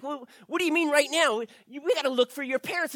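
alarmed protest. Acted. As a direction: argue back in a panic. David protests leaving.

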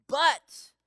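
"But" punched out high and loud; stress on But. Shown as a demonstration. Pastor stressing the psalm's turning word, loud and high. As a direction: hit "But" hard, like you underlined it. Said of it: "I want you to underline that word".

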